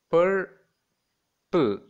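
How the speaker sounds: two widely spaced beats in "purple"; syllable by syllable. Shown as a demonstration. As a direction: stretch and split "purple" between R and P. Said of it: "divide the word between two middle consonants".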